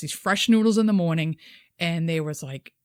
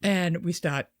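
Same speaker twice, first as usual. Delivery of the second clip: ordinary delivery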